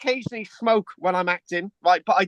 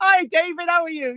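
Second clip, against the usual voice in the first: put-on greeting an old mate, much higher and upbeat; bright cheery hello; 9 semitones higher than usual. Retelling bumping into David, an upbeat high greeting. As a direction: hail him like a long-lost friend.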